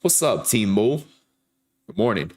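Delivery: ordinary talk, nothing marked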